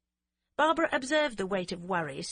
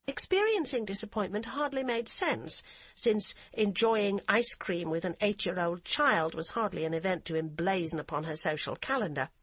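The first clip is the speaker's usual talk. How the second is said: plain reading voice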